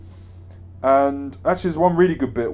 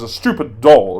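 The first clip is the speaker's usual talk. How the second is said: loud, sneering villain bark; Heisenberg impression; 11 dB louder than usual. Heisenberg throwing a knife at a portrait, loud theatrical sneer. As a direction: spit out the insult theatrically. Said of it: "he talks like this".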